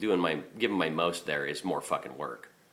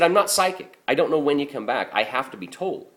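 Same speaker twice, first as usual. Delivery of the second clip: much higher and louder, frustrated plea; frustrated outburst; 10 semitones higher, 7 dB louder than usual. Retold or imitated. Garbage man to the complaining customer: frustrated, loud plea. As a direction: pour out your frustration, voice pushed high.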